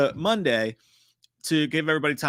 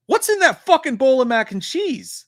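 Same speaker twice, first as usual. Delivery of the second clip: much higher and louder shout; mock outrage; 12 semitones higher, 9 dB louder than usual. Streamer yelling at a chatter about mac and cheese, mock outrage. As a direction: demand it in comic alarm.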